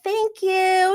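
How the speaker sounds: high-pitched imitated thank-you; mock grateful guests. Guests thanking the departing chef, mock-grateful high voice.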